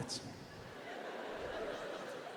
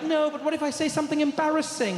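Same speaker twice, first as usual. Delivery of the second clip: higher, fretting protest; mock worried; 7 semitones higher than usual. [name] dodges the order, high mock worry. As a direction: feign dread of a blunder.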